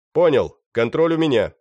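loud shouted reply; shouting. Acted. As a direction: call it back loudly across the yard. Напарник откликается криком. (громкий ответный крик)

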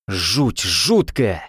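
очень медленно, нарочито растягивая; протянуть с шутливым ужасом; восклицание, растянуто. A narrator's reading. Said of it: "воскликнул Грон". (Грон смакует неудачу соперников, восклицая)

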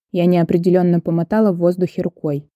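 usual speaking voice

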